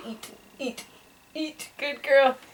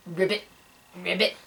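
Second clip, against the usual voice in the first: short, low croaked ribbits; frog ribbit; 4 semitones lower, 5 dB quieter, faster than usual. Shown as a demonstration. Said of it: "What's a frog say?".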